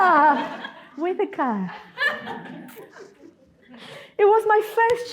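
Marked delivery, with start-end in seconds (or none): 0.0-0.7 long drawn-out "ah" of alarm; mock panicked cry; re-enacted startled cry. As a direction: act out a swerving yell, held long. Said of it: "and I did this".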